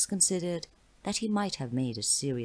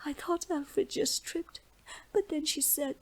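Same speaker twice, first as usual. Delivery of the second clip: put-on tearful girl; 14 semitones higher than usual. A narrator's reading. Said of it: "hiccuping the story out in short fragments between sobs".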